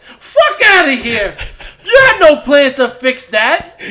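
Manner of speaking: cracking up while scoffing; laughing exasperation; laughing disbelief. Real, not acted.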